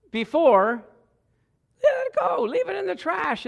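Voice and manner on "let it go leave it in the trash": preacher urging release, high; soaring command. Real, not acted. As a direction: cry it out to let go. Emphatic command.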